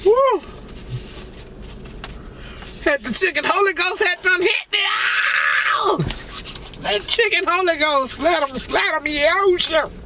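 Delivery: high shouted praise like being struck by the spirit; church holy ghost shout. Pretend. Mock church praise over the chicken, high hollering.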